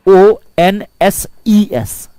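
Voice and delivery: slow, each letter of ओ एन एस ई एस separate; spelled out slowly. Shown as a demonstration. Teacher spelling समन्सेज slowly for the class. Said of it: "समन्सेज".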